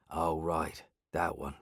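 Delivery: put-on soft, low old local voice; lightly voiced old man, mumbling softly; Benny's voice. A narrator's reading. Benny, old boardwalk resident.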